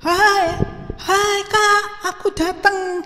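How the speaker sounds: high, drawn-out sing-song hello; calling greeting. A narrator's reading. Younger brother arriving greets his older brothers, calling greeting. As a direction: call out to big brothers in a high voice. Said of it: "Yusuf sudah mulai menyapa".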